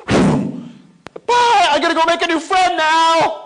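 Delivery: put-on high, loud little-kid chirp; excited little girl. Dad mimics his little girl running off to the swings, high and loud. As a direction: squeal it like an eager small child off to play. Kid daughter voiced in story.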